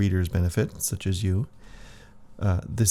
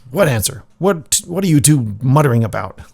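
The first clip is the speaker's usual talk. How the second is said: irritated snap; 5 semitones higher, 8 dB louder than usual. Acted. Jaguar Priest snaps at them.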